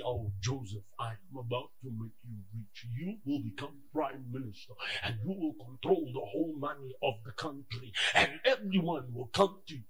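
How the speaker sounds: low, soft grand promise; low soft God voice; imagined voice of God. Retold or imitated. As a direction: murmur a divine promise. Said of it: "did not hear God say".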